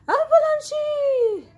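very high, loud alarm; stretched, high-pitched emergency shout; urgent cry. A narrator's reading. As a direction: scream a warning to the children. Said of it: "cried Grand Dude".

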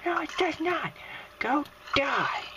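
high-pitched, indignant retort; angry dismissal. Real, not acted. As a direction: snap back in a high, cross voice. Turns on the enemy villager.

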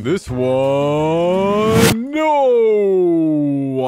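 loud, high drawn-out no; long protesting cry of no; dramatic no. Real, not acted. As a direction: stretch out a horrified no. Dreading the next flag.

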